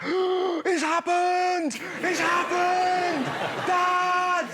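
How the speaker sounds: put-on high, slow, shouted child's joy; overjoyed kid. Seven-year-old scoring a goal, calling his dad in ecstatic shouts. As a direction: yell it like a kid who just scored. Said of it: "he was like".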